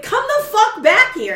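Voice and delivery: high, loud shout after him; furious yell. Retold or imitated. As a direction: call him back with full force. Yelling after fleeing thief.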